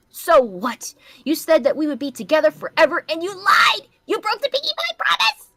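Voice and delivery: high, loud mock pony outburst; hurt accusation. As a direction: yell it like a betrayed cartoon pony. Character accuses in fanfic reading.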